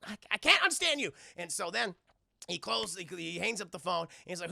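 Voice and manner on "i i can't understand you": Chris Tucker. Retold or imitated. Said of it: "And Chris Tucker's like".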